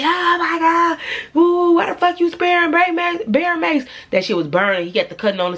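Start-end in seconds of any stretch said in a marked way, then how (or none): 0.0-3.9 panicked scream. Retold or imitated. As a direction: shriek in pain as the mace burns. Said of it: "So he".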